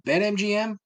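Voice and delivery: BetMGM jumped up high in mock hype; mocking the ad's hype; mock ad announcer. Shown as a demonstration. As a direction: sing out BetMGM like a cheesy jingle. Repeating sponsor name mockingly.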